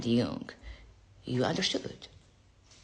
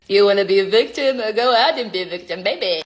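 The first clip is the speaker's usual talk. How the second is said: mock Freud; 5 semitones higher, 8 dB louder, faster than usual. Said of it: "Freud built ninety-nine percent".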